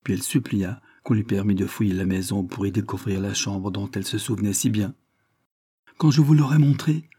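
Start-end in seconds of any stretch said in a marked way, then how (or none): 6.0-7.1 higher, louder, confident call; cri assuré. A narrator's reading. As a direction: raise the voice with assurance. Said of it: "cria-t-elle d'un air d'assurance". (s'écrier avec assurance, voix haute)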